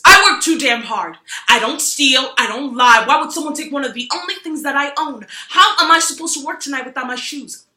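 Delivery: loud, angry shouted complaint; retold screaming. Woman at the center yelling about her stolen shoes, angry shout. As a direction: yell it like she's been robbed. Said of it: "screaming at the top of her lungs".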